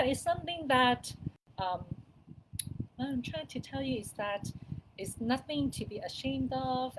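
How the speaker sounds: normal talking voice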